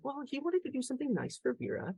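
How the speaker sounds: imitated little girl, high and soft; young daughter. Daughter explaining the boy's gift to dad, imitated high innocence.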